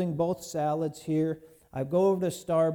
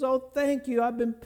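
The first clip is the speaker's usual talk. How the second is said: high, bursting with relief; overjoyed thanks; 6 semitones higher than usual. Retold or imitated. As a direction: gush it out with joy. Owner gets her lost ring back.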